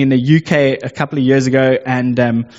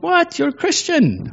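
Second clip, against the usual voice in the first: much higher, thrilled disbelief; surprised. Retold or imitated. Learning a new coworker goes to church, delighted surprise. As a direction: squeal at the news.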